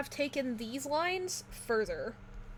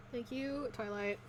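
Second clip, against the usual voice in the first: usual speaking voice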